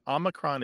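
Omicron said "AH-mi-cron"; preferred short-O pronunciation. Shown as a demonstration. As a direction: begin Omicron on a short, open "ah". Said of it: "is probably the better pronunciation".

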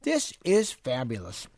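slow, savoring "This is fabulous" with food in mouth; drawn-out praise while chewing; mouth full. Real, not acted. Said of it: "You better stop chewing".

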